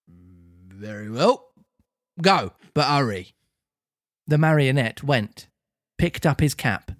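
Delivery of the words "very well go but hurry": slow, lower policeman permission; officer lets the puppet fetch his cap. Acted. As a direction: grant it grudgingly like a stern copper.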